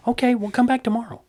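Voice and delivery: high, bright, loud farewell; a breezy goodbye to a man who just backed down; cheery mock-friendly. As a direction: send him off with sunny politeness. Cheery reply to retreating guy.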